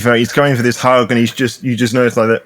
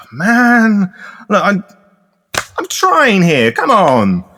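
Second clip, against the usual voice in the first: higher, put-upon appeal falling away at the end; exasperated, raised-pitch pleading; exasperated plea; 6 semitones higher, much slower than usual. Acted. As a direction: plead like someone whose efforts go unappreciated. Game master voices exasperated baron pleading.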